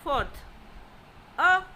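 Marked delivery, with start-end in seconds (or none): 1.4-1.6 "a" short and stressed; falling tone. Shown as a demonstration. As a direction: fourth tone on "a". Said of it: "falling tones".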